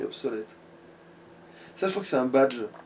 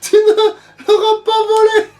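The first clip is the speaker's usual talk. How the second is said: a much higher sorceress casting a curse; witch voice; 10 semitones higher, 3 dB louder than usual. Acted. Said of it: "je vais faire cette voix en fait".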